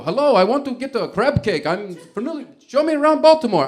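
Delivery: high, loud, overdone Italian tourist; bad Italian accent. Comic tells of Italian guest, hammed-up Italian accent. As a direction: ham up an Italian accent, rising to a cry. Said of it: "I can't do an Italian accent".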